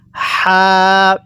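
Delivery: long-held হা with a light puff of air; airy হা. Shown as a demonstration. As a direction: let light breath out through the mouth on হা. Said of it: "মুখ দিয়ে আমরা হালকা বাতাস বের করব". (হালকা বাতাস ছেড়ে হা)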